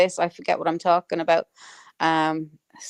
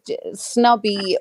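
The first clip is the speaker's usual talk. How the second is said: ordinary talk, nothing marked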